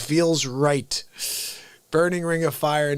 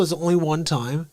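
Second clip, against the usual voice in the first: ordinary talk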